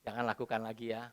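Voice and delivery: put-on soft, low, gentle words to a child. Father gently forgiving his son, soft and low. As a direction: soothe the child softly. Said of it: "saya senyum".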